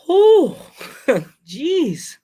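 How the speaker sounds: high loud whew then jeez; shocked whew. Retold or imitated. Reaction to crash photo.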